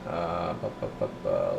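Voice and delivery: ordinary talk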